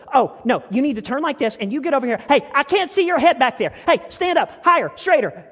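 loud, high, bubbly photographer directing poses. Retold or imitated. Photographer Lindy posing the family, high and bubbly. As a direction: boss the family into poses with bounce. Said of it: "She's bubbly, she's fun, and she'll go".

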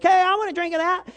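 put-on a child begging for a sip of grandpa's coffee; eager child voice. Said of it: "you're like".

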